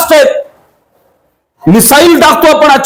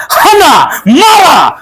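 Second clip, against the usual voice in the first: higher, rallying war cry; battle shout; 5 semitones higher, much slower than usual. Acted. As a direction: roar at the troops to attack. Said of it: "Bajiprabhu ओरडला". (बाजीप्रभूची उंच आवाजातली युद्धघोषणा)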